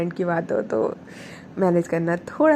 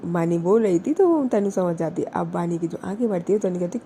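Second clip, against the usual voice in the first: usual speaking voice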